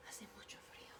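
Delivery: whispered, breathy aside. Acted. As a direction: whisper it as if chilled. Whispered cold remark.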